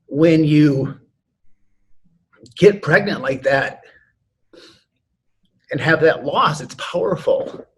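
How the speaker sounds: holding back tears, slow; choked up. Real, not acted. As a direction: voice catching with emotion. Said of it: "I'm almost getting choked up".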